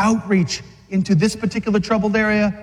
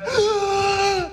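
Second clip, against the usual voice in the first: high, strained gasp with a crying edge; sharp pained gasp; 12 semitones higher than usual. Retold or imitated. A preacher acting out Jesus on the nails, gasping in pain. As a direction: suck in a deep, agonised breath. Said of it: "took a deep breath".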